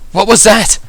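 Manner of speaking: loud, very high startled cry; high-pitched shocked exclamation; startled alarm. A narrator's reading. Reaction to explosion amid screaming.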